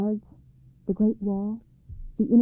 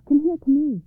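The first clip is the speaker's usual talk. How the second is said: high, loud excited summons; princess's raised, urgent call; crying out; 6 semitones higher, 6 dB louder than usual. A narrator's reading. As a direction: call them over eagerly, voice lifted. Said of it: "she cried".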